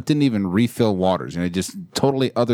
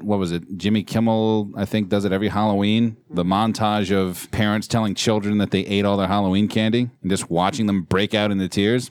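ordinary talk, nothing marked

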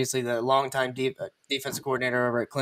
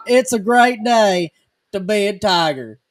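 slow, louder, higher drawled slogan; hammed-up Southern catchphrase; 6 semitones higher, 8 dB louder than usual. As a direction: belt it like the coach at his first speech. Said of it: "mimicking the coach's catchphrase".

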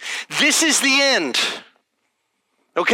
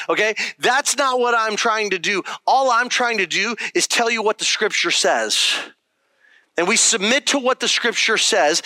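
ordinary delivery

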